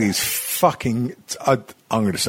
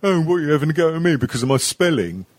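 higher, hurried mock indignation; annoyed, mocking imitation; 6 semitones higher, much faster than usual. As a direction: imitate an offended commenter, high and fast. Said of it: "they get so annoyed".

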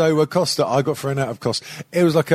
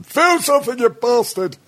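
mock yell; 4 dB louder than usual. Yelling at his own body part.

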